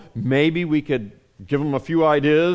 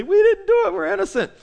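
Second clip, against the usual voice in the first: higher, panicked pleading voice; scared near-screaming; 7 semitones higher than usual. Acted. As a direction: blurt it out like terrified men. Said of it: "They're screaming practically".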